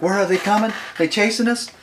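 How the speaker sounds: high, loud urgent questions; mock alarm. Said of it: "He's panicking. He's breathing so hard. I'm like".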